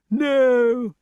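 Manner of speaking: high, loud, drawn-out no; high-pitched eccentric king's lament; dotty king. Acted. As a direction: wail the no high, as a hapless king. Pellinore answers about the beast.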